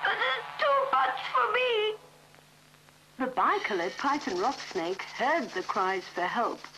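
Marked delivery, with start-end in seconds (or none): 0.1-2.0 high, strained plea; overwhelmed little child crying out; distressed child. Acted. Elephant's child pulled by the crocodile, high strained plea.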